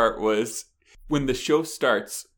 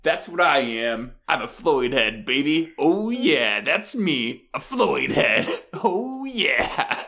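hyped-up imitated fan voice, swooping higher on the yeahs; self-satisfied new fan; 4 semitones higher, 4 dB louder, slower than usual. Woman declaring her new fandom, hyped-up imitated voice. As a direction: play her revelling in her new identity. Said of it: "the woman goes".

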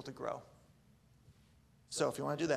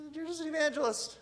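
mock amazed; 11 semitones higher than usual. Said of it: "you're like".

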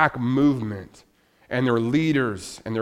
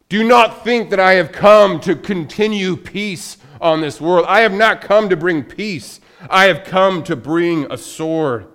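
proclaiming loud; 5 semitones higher, 9 dB louder than usual. Acted. Jesus voiced defying false peace.

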